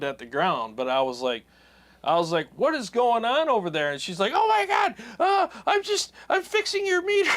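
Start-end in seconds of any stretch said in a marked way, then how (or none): 2.6-3.9 high, mock-alarmed call across the yard; retold alarmed challenge. Retold confrontation with a stranger outside. 4.3-7.3 imitated panicked shriek, very high; freaking out. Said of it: "She was, like, freaking out".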